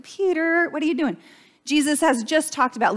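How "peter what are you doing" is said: mock rebuke. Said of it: "Peter hears, like".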